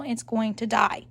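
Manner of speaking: usual speaking voice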